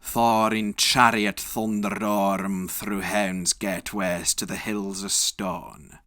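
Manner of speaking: plain reading voice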